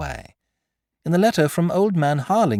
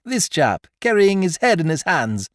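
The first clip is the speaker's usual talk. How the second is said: high, louder, teasing glee; gleeful taunt; 6 semitones higher, 4 dB louder than usual. A narrator's reading. Beautrelet taunting the Count with the revolver, gleeful. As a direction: dare him to shoot, delighted.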